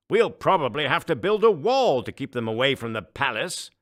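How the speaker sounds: a booming, self-important ruler; pompous emperor. Acted. Emperor frowns.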